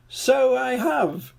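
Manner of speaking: high, slow, giggly king voice; giggling king. A narrator's reading. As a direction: giggle out the joy. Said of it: "he giggled".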